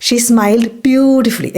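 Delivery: slow, careful teacher read; deliberate classroom pronunciation; slow model sentence. Shown as a demonstration. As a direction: spell it out slowly for learners. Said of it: "പൊതുവെ adverbs ലീ".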